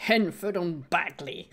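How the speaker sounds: put-on slow copy of Henford on Bagley in British accent; imitating British accent. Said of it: "comment il le dit?". (imitation lente de l'accent anglais)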